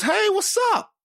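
high, loud mock shout of hello; loud greeting. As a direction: call out hey across the room. Friend greets cousin's wife loudly.

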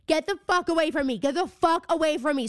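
angry retold yell, not full force. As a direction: snap it at a creepy actor, reliving the fright. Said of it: "I start, like, screaming at this girl".

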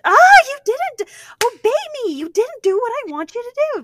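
mock outrage at being disobeyed; mock hissy fit. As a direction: shriek it like a spoiled brat who didn't get obeyed. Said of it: "you don't have to have a big fanfare, like, and throw a hissy fit".

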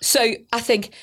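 ordinary talk, nothing marked

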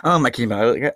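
usual speaking voice

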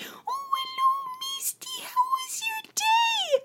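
extremely high, drawn-out squealy greeting; slow, sky-high baby talk to a pet; mom greeting the cat. Retold or imitated. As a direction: imitate a mom cooing at her cat. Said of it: "So when my mom comes home".

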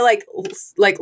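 ordinary talk

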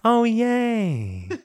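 understated, high-voiced celebration; small sheepish hurrah; gentle little cheer. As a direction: answer the party with a meek cheer. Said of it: "very gently, very sheepishly".

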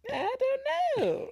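very high, slow, drawn-out squeak; sing-out shrug, stretched at the top of the voice; comic uncertainty. Real, not acted. Host unsure about torturing the torturer, high comic uncertainty.

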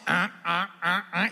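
steady, evenly spaced honks; imitated mother-goose honks. Mother calling amid a crowd of geese, steady honks. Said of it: "la mamá hace".

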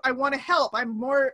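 usual speaking voice

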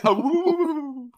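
high, loud mock ghost wail; spooky ghost wail, high and loud; ghost woo. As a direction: hoot like a cartoon ghost.

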